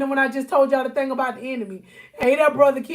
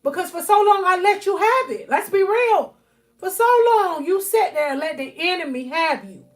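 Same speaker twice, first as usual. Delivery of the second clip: louder, higher, building sermon cadence; full-force preaching; 4 semitones higher, 4 dB louder than usual. Acted. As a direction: preach it hard to the congregation.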